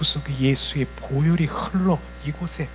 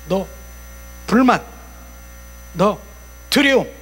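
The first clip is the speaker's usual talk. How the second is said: loud, high single-word summons with pauses; single out each culprit by name; pointed calling-out; 5 semitones higher, 7 dB louder than usual. Shown as a demonstration. Preacher picking out spirits by name, pointed calling-out. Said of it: "이렇게 딱 찝잖아요".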